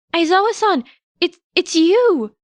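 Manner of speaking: put-on high, loud boyish cry of joy; breathless high cry of happy disbelief; overjoyed recognition. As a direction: burst out, he's really here. Boy recognises his teacher.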